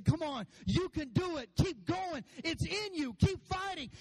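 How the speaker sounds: high-pitched rallying cry; impassioned cheer from the stands. Acted. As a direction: urge a flagging fighter onward. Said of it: "they're shouting, and they're cheering for you".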